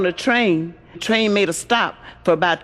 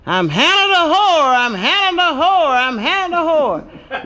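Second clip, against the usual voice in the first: parrot hollering; 8 semitones higher than usual. Acted. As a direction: belt it out like a shameless bird. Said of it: "the carrot started hollering".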